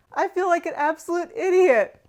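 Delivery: high and loud at herself; exasperated self-mockery. Real, not acted. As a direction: cry out at your own blunder. Laughing at own mistake.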